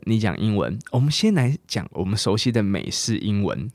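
ordinary delivery

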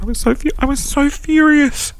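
bursting out, worked up about it. Real, not acted. Recalling the rage.

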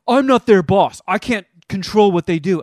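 mock shouted retort; defensive shout. Retold landlord shouting back.

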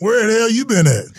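high, loud gruff demand; mock coach yell. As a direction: bark it like a coach catching a late rookie. Coach scolding late rookie.

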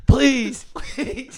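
high pleading coach on his knees, loud then breathy; mock begging. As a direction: beg the line judge, voice shooting up.

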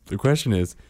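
ordinary delivery

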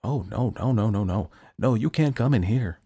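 lightly voiced alarmed saint; Saint Peter voice. A narrator's reading. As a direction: refuse him firmly. Peter refusing Jack.

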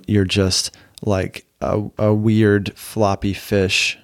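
drawn out slowly, word by word; slow punchline. Real, not acted. Podcaster slowly savoring his silly punchline. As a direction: stretch each word like a comic image.

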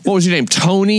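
high mocking voice, playing dumb; mock clueless question. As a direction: ham up the silly name guess. Mocking a stereotype name guess.

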